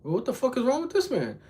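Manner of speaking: high-pitched baffled onlooker; squeaky disbelief, acted; mock bewilderment. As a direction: imitate someone weirded out, voice shooting up. Onlookers react to the scream.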